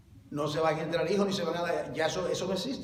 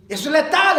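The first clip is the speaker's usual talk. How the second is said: loud warning; 9 semitones higher, 12 dB louder than usual. Acted. Repeated louder warning.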